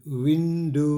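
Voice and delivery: विंडो with a long final O sound; drawn-out demonstration. Shown as a demonstration. Teacher showing the O sound in विंडो, slow and stretched. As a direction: stretch विंडो slowly, WIN-DOOO. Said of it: "pronounce ओ".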